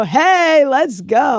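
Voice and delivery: high, celebratory whoop of words; excited cheer. Real, not acted. Podcaster celebrates the show's first NFL mention, excited cheer.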